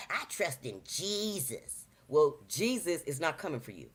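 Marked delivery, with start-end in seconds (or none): 0.1-1.9 slow, soft, mock-devout imitation; mocking pious voice. Mocking people who claim intuition, in a slow mock-pious voice. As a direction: parody a smug believer. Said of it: "some people be like".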